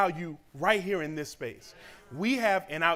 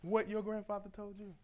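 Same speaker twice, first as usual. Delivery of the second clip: gentle mentor; 5 semitones higher, 8 dB quieter than usual. Retold or imitated. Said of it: "she told me".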